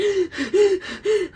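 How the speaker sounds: high gasping sob; sobbing gasp. Retold or imitated. Fourteen and crying, a high gasping sob. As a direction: gasp for air like a crying kid. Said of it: "I'm all like".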